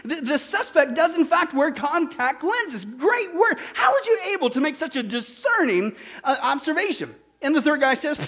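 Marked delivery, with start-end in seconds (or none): 0.0-7.1 high-pitched, marvelling policeman; amazed and smiling. Acted. Policeman back from checking the file, smilingly amazed. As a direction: gush in comic astonishment, stretching key words. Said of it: "he comes back smiling".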